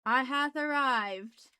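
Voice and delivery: hammed-up lofty arrival; mock grand entrance. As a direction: announce yourself like royalty, tongue in cheek.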